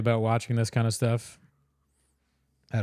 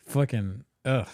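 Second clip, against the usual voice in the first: ordinary talk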